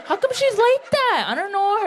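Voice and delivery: high, laughing mock gossip; gossiping sister. Sister gossiping at the reunion, high and laughing. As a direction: cackle through the gossip. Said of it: "We gossip about all our family".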